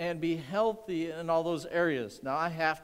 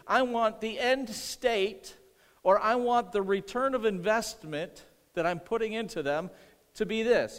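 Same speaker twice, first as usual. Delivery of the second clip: slow, raised, weighty paraphrase; emphatic preaching; 5 semitones higher, 4 dB louder than usual. Acted. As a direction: drive the point home slowly.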